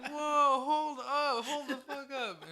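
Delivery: high, very loud alarmed halt; shocked protest. Retold or imitated. Skater retells reacting to homeless man's proposition, shocked. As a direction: re-enact stopping someone in shock.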